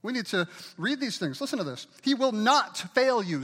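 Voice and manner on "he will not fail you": "not" hit slow, high and loud; stress on NOT. Shown as a demonstration. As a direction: punch the word "not" slowly. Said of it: "Say "not".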